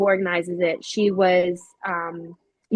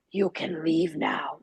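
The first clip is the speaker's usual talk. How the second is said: slow, softer, eerie male voice; ghostly man's voice; 3 semitones lower, 6 dB quieter, slower than usual. Retold or imitated. Unseen man in the house telling her to leave, eerie. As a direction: drag it out like a spirit warning her off. You hear a man clear as day.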